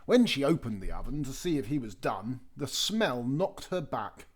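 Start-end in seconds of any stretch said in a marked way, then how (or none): none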